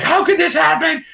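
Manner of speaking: high, panicked wail; mock panic. Lady on the show having a panic attack, high panicked cry. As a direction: squeak it out like someone losing it. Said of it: "she's having a panic attack".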